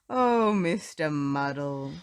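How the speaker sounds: drawn-out sigh of a name; exasperated George. A narrator's reading. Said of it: "said George".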